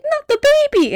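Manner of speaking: very high wail, hammed-up; mock horrified plea. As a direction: plead in playful horror. Retold horrified plea for Junior.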